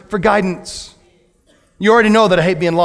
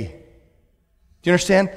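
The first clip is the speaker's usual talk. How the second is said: ordinary delivery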